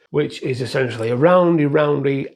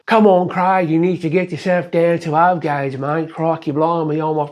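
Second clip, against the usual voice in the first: mock Aussie mate egging a friend on; horrible Australian accent; 3 semitones higher than usual. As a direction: friend teasing him about O gauge, in a hammed-up Australian accent. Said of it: "Horrible Australian accent".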